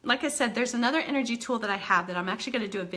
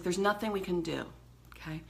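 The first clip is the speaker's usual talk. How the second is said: ordinary delivery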